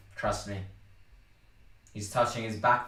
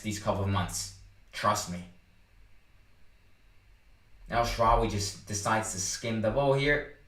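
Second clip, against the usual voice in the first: ordinary delivery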